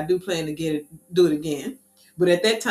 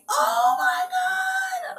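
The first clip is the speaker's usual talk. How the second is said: high, slow, stretched scream; a long, high panicked shriek; terrified scream; much slower than usual. Retold or imitated. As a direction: scream it in terror as you fall. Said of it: "I'm screaming".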